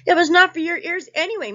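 loud, high taunt; called out. A narrator's reading. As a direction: call it across loudly, teasing. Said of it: "Muskrat called out".

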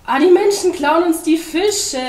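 high, loud, drawn-out complaint; slow, high yell of grievance; shouting. A narrator's reading. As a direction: cry out in outrage. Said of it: "schrie der Pinguin".

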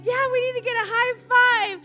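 high, loud whoop for a high five; pumped-up shout to her husband over the sink; excited cheer. Retold or imitated. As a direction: cheer it like you just won. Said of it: "we got so excited".

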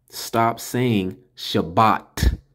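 very slow, deliberate stop saying Shabbat; slow insistent warning; slow emphatic. Shown as a demonstration. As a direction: lay every word down slowly. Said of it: "I have to put emphasis on this".

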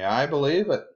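high mock yell in reply; yelling back. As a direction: pretend to holler back from a distance. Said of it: "you can yell back to me".

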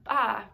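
put-on a playful baa for the kids; lamb bleat. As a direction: bleat like a lost little lamb.

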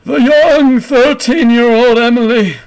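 put-on high, slow, drawn-out old-man proclamation; hammed-up old man's announcement breaking into laughter. Old man proclaims the young successor to the crowd, old man voice. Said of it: "you hear this old man going".

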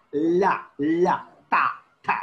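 "La" and "ta" with tongue on upper teeth; dental sounds. Shown as a demonstration. Teacher showing danta sounds, dental. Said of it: "Your tongue will be pressing it".

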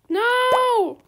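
high, loud anguished no; dismayed cry. Real, not acted. Trader's offer is refused, loud dismayed cry. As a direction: wail at the rejected trade.

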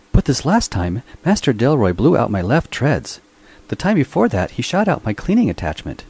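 high, prim robot servant voice, aggrieved; a fussy mechanical butler sulking about being shot; whiny robot voice. A narrator's reading. Robot Minx protests to his master, high and aggrieved.

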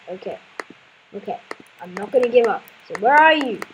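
ordinary talk

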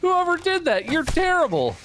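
very high, squeaky scolding; comic outrage. Real, not acted. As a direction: scold the unseen designer in a high wail. Outraged at badly made game snake.

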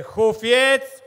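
high, loud, drawn-out triumphant call; excited announcement. Real, not acted. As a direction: announce the winner like a stadium MC. Big winner announcement at ceremony.

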